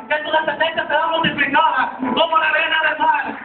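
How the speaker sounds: high, driving preacher's delivery; impassioned sermon storytelling; full-force preaching. Real, not acted.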